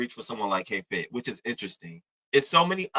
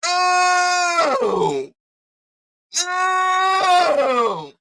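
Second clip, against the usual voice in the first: high, loud, drawn-out mock shout; mock disbelief; 6 dB louder, much slower than usual. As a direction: wail it in exaggerated disbelief. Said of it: "I'm sitting back like".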